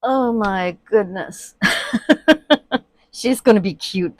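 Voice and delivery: ordinary talk, nothing marked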